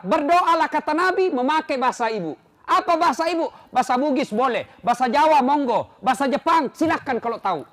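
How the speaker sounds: loud, high, rousing sermon; full-force preaching. Acted. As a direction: lift the crowd with each line. (khotbah bersemangat dan lantang)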